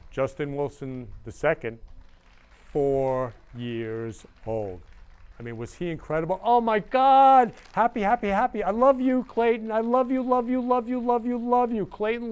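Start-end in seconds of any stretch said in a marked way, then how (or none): 6.4-12.3 higher and much louder, gushing with joy; shower her with love at full volume; ecstatic gushing. Real, not acted. Thanking fan Clayton after the show, ecstatic and loud.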